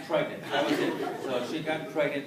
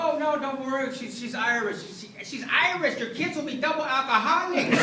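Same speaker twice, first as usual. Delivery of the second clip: mock reassuring son; 10 semitones higher, 7 dB louder than usual. Reassuring father in raised voice.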